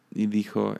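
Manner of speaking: normal talking voice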